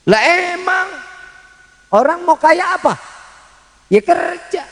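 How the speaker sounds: high, lightly put-on voice of a doubting listener; mock listener voice. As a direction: play the congregant asking back.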